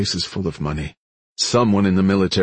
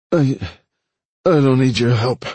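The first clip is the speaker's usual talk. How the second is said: halting, higher, slurred refusal; slurred growl; 6 semitones higher, 4 dB louder than usual. A narrator's reading. Wounded woman refusing help, slurred growl. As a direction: growl through slurred words. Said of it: "Nola growled, her words slurring".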